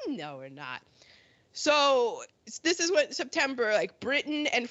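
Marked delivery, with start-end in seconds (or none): 0.1-0.8 low, soft brush-off; mock Germany, lower and quieter; dismissive Germany. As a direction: drop the voice and deny it flatly. Quarrel of two voiced countries.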